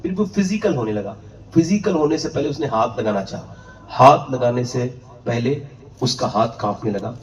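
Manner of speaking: usual speaking voice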